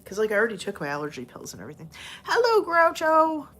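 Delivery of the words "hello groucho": much higher, louder, slow sing-song greeting; slow high-pitched greeting to Groucho; pet talk. Real, not acted.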